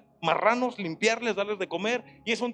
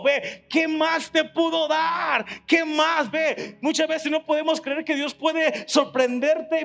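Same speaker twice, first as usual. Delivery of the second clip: raised, ringing preacher's voice; preaching at full force; 4 semitones higher than usual. Real, not acted. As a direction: build the fervour and drive it home. Preacher building to climax. (predicando con fuerza y voz elevada)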